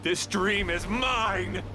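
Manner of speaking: slow, high, possessive declaration; defiant claim. Acted. Villain claiming the dream as his own. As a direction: drag out each word in triumph.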